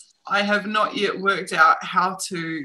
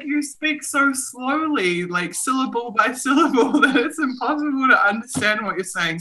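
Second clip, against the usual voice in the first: lightly voiced friend, higher, laughing; imitating Ksenia; 7 semitones higher than usual. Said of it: "She's like".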